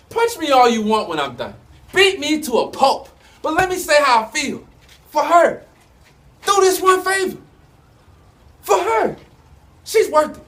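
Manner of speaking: impassioned plea. Acted. Man begging rival to hear him out for her, impassioned plea. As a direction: beg urgently, pitch rising as you go. Pleading with a rival before fight.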